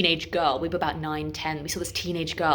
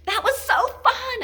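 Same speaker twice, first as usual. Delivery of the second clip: very high, squeaky mock delight; fake enthusiasm; 4 dB louder than usual. Hurt diver hides pain from friends, squeaky fake cheer. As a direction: squeal it, badly faking that you're fine. Pretends fine after painful back smack.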